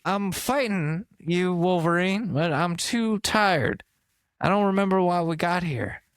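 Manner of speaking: half-hearted superhero too tired to fight; tired Deadpool Biden. Retold or imitated. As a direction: drag the words out like a worn-out fighter. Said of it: "Deadpool Joe Biden".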